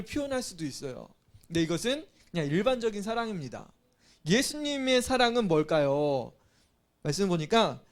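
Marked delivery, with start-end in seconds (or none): none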